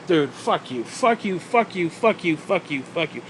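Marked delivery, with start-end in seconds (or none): none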